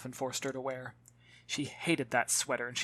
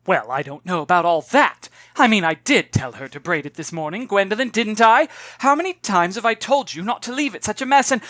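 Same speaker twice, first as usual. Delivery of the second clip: loud, embarrassed, defensive rambling; flustered mother; 5 semitones higher, 9 dB louder than usual. Acted. Embarrassed mother blaming her daughter before the woman. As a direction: turn the blame on the daughter.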